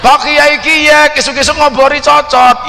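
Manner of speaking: high, slow Tegal ngapak offer; a Tegal host offering the kiai a cigarette at dawn; ngapak accent. Retold or imitated. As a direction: drawl the offer in broad ngapak. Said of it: "pakai bahasa ngapak".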